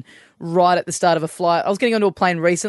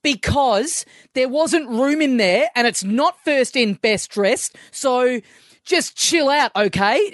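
angry dad; 6 semitones higher, slower than usual. Retold or imitated. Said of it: "the dad just cracks it and goes".

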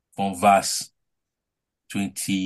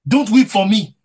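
emphatic repeat; 13 semitones higher, 12 dB louder than usual. Acted. Preacher repeating Jesus' words.

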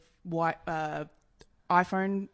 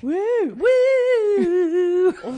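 loud high cheering whoop; self-hype woo; 11 semitones higher, 12 dB louder than usual. Real, not acted. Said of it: "a fan of you wooing yourself".

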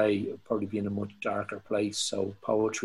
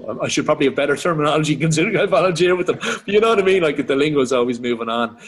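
excited; 5 semitones higher, 9 dB louder, faster than usual. Real, not acted. Animated self-mocking aside.

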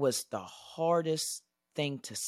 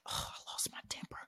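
a whispered realization; hushed, shocked at yourself; whispering realization; 12 dB quieter than usual. Retold or imitated. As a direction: breathe it out, stunned. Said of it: "I said".

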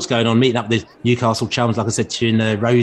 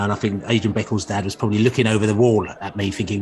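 ordinary talk

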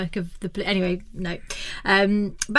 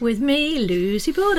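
elongated; 5 semitones higher than usual. Real, not acted. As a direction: stretch out your own name a little. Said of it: "not as elongated".

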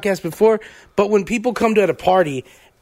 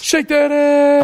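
slow, drawn-out, higher and louder hype; hype call; 7 semitones higher, 3 dB louder, much slower than usual. Acted. Co-host hypes the ladies' contest, slow drawn-out hype call. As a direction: stretch it out like a club hype man. Hyping the Wet and Wild contest.

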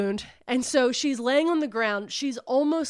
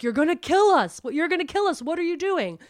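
higher, louder mock scream; raised, high-pitched acted yelling; retold scream; 7 semitones higher, 6 dB louder, faster than usual. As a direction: imitate someone screaming in alarm. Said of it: "they're screaming".

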